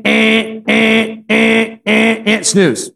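flat, high repeated electronic beeps; imitated alarm buzzer. Preacher imitating his roommate's early alarm, buzzer-like beeps. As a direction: blare like an alarm clock, evenly spaced.

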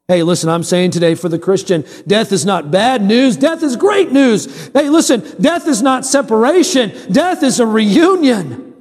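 slow, high-pitched emphatic sermon; preaching. Real, not acted. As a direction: build to a ringing proclamation, stretching the key words. Preaches after joking aside.

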